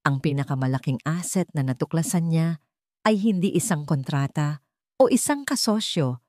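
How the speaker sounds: ordinary delivery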